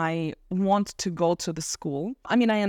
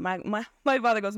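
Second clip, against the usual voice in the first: ordinary delivery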